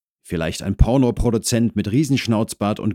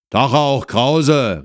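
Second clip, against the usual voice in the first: raised, loud greeting from indoors; called out; 7 semitones higher, 6 dB louder, slower than usual. Acted. Husband greets neighbour from inside the flat, calling out. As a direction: call a short greeting to the door. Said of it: "rief der Mann aus der Wohnung".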